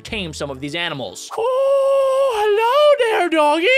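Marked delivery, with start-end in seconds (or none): 1.4-3.7 put-on baby talk. Meeting a wild dog.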